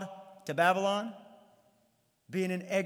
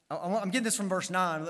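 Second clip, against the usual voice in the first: ordinary talk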